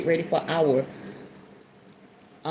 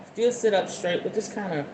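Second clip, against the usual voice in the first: guided meditation. Acted. Guiding viewers into a calm sitting posture. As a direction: settle the listeners into their seats.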